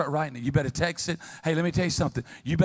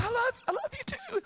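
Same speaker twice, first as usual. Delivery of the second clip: much higher, soft sweet-talk; mock lovey-dovey; 6 dB quieter than usual. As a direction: coo it like a smitten fiancée. Mimicking couple's sweet talk.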